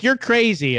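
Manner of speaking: high-pitched retold exclamation; mock disbelief. Crew reacting to Elnor's choice.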